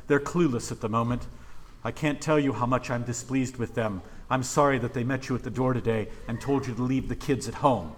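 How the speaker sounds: a hint of Jesus apologizing for his disciples; gently apologetic Jesus. Jesus apologizing to the families, lightly voiced and soft. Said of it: "hear Jesus say something more like".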